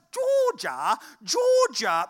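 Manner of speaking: put-on high, loud caricature of a little boy; put-on toddler voice, calling out high; three-year-old boy. Three-year-old calls his sister from the back seat, exaggerated high child voice. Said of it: "he doesn't really speak like that".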